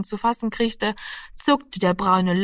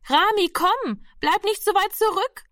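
high-pitched call to a straggler; 12 semitones higher than usual. A narrator's reading. Sister calls Rami back to the group, calling out. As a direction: call the boy along from a distance, pitched well up. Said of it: "rief die Schwester schon wieder".

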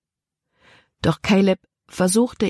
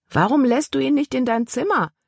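raised pitch calling out; calling after; 8 semitones higher than usual. A narrator's reading. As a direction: call out after her. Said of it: "rief Caleb ihr nach".

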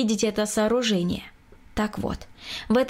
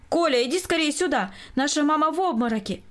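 urgent call, pitched higher; 6 semitones higher than usual. A narrator's reading. Husband calls his son to his fainting wife, calling out urgently. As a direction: call for help in a raised voice. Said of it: "громко позвал".

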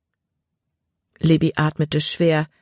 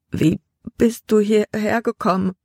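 teeth chattering; 5 semitones higher than usual. A narrator's reading. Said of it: "mit klappernden Zähnen".

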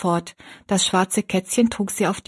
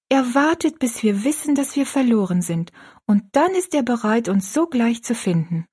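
put-on higher-pitched, earnest girl's voice; reassuring young girl; 4 semitones higher than usual. Girl explaining the good shepherd, earnestly reassuring. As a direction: comfort her with simple certainty. Rosalie's character voice.

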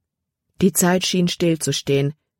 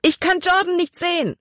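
very high, alarmed outcry; panicked cry; 13 semitones higher than usual. A narrator's reading. Libby cannot find Jordan in the water, panicked cry. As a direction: cry out frantically, pitched right up. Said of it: "schrie sie".

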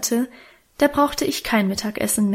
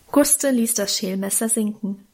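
ordinary delivery, nothing marked

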